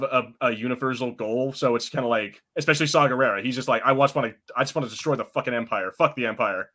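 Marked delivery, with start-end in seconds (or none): none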